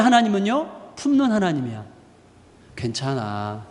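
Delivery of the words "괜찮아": lower, softer, gentle it's okay; reassuring. Retold or imitated. Embracing God comforting a person, soft. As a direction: hold them close with the voice.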